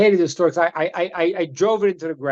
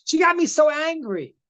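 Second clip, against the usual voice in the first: put-on whiny kid; 8 semitones higher than usual. Child excuse.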